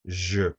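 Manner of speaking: "je" with a soft zh sound as in measure. Shown as a demonstration. Teacher showing the soft G sound in "je". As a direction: say "je" with the French soft G, like the s in pleasure. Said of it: "we want to preserve the sound".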